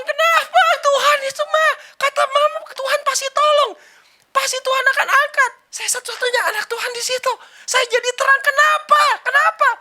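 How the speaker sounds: put-on high, loud wailing little girl; crying child. Girl crying to her mother after losing, high and loud. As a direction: wail it like a heartbroken child. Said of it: "Nangis lah ini anak".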